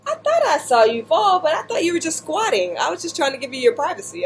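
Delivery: high, fast, flustered friend explaining herself; apologetic friend. Retold or imitated. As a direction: rattle it off as the embarrassed friend. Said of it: "She's like".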